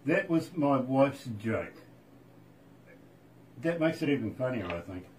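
usual speaking voice

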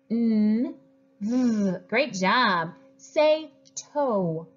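normal talking voice

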